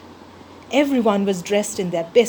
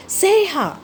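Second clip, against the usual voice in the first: even higher, more desperate call; higher second shout; 10 semitones higher than usual. A narrator's reading. Mouse calling again for lost friend, higher desperate shout. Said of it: "I shouted".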